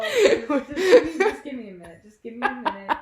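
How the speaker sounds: tearful, half-laughing plea; in tears. Real, not acted. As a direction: beg for a pause through tears and laughter. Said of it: "is bringing you to tears".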